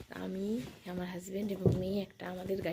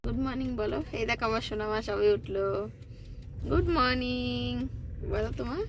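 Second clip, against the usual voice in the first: high, bright coaxing tone; 5 semitones higher, 4 dB louder than usual. Real, not acted. Waking the daughter sweetly.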